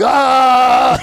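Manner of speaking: loud, high mock yell; drunken roar. Imitating a drunk chief smashing things, wild yell.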